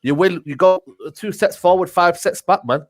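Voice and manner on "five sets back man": ordinary talk, nothing marked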